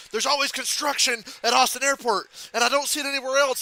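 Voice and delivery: high-pitched mock complaint; Bernie arguing. Retelling Bernie's construction argument, mock-indignant. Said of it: "him and Bernie are arguing".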